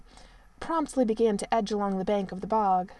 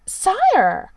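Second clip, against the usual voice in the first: shocked, prim outcry, much higher and louder; scandalized; 10 semitones higher, 11 dB louder than usual. A narrator's reading. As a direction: gasp it out, properly shocked. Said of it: "in a scandalized tone".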